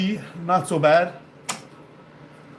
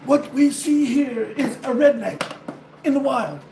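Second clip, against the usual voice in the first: David Attenborough; 8 semitones higher than usual. Acted. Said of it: "In my David Attenborough voice".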